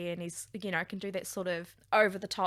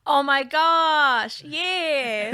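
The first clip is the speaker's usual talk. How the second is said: loud, stretched, high delighted gasp; genuinely thrilled by the pick; excited gush; 5 semitones higher, 10 dB louder, much slower than usual. Real, not acted. Cohost hears Mark Ruffalo named, loud excited gush.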